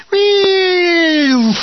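high, loud falling shell whistle; mock shell whistle. Imitating incoming shell.

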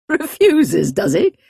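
put-on high, amused old woman; mock it with a cackle; cackling old lady.

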